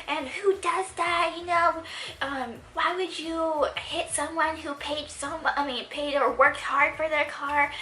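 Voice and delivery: high-pitched, indignant imitation of the car owner; mock indignant yelling. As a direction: whine it up high like the outraged stranger. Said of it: "going like this".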